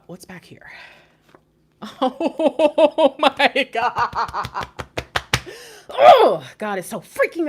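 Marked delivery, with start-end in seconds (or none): 0.1-0.7 breathy hushed whisper of curiosity. Real, not acted. Reader whispering to herself while looking at cards, hushed. 5.9-6.5 sudden high, loud gasp of surprise; startled oh. Real, not acted. Reader suddenly shocked by what she sees, startled.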